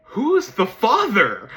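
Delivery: high, loud, drawn-out question; exasperated demand. Acted. Fan puzzling over Rumi's parentage, exasperated demand. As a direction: demand an answer, slowly and loudly. Demands answer to plot hole.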